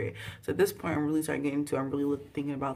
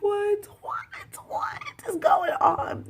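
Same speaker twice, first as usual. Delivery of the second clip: very high, stretched-out squeal of shock; hammed-up squeaky horror, drawn out at the end; shocked disbelief; 4 dB louder, much slower than usual. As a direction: let mock shock climb sky-high. Acts out shock at film scene.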